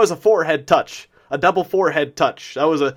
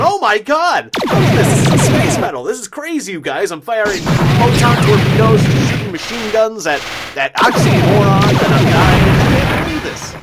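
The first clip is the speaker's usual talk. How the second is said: higher, breathless gamer excitement; really excited; 5 semitones higher than usual. Retold or imitated. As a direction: gush like a kid in a space battle. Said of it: "I was really excited".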